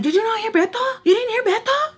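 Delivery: very high-pitched, incredulous outburst; mad. Retold or imitated. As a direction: get in her face, outraged. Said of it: "in her face, like, mad".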